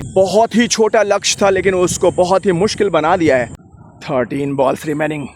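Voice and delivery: ordinary talk, nothing marked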